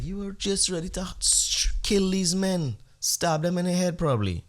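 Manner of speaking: high, incredulous scolding, half whispered at first; mock incredulous. Husband teasing his wife, high and incredulous. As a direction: needle her with disbelief. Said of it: "and I was like".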